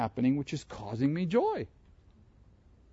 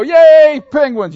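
loud, high excited cheer for the Penguins; team cheer; 12 semitones higher, 17 dB louder, much slower than usual. Acted. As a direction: whoop it up like a fan in the stands. Cheering his hockey team's win.

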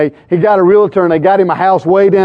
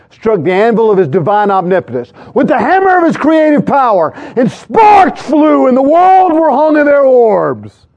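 building preacher's crescendo; 6 semitones higher than usual. Retold or imitated. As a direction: preach it bigger and bigger, stretching the final words. Quoted preacher's climactic creation line.

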